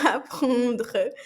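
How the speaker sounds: "Apprendre" com o R raspado na garganta; throaty French R. Shown as a demonstration. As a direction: puxe o R do fundo da garganta. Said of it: "tirando o pigarro da garganta". (R gutural francês)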